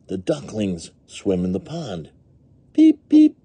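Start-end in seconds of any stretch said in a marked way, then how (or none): 2.7-3.4 two very high, loud cheeps; duckling peeps. A narrator's reading. Ducklings swim in the pond, high loud peeping. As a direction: squeak like ducklings.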